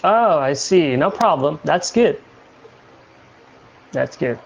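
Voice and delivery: normal talking voice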